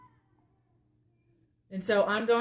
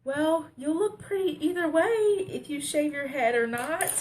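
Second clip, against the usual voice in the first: put-on higher sweet boyfriend voice; imitating boyfriend; 8 semitones higher than usual. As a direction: quote him high and sweetly. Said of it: "but he was just like".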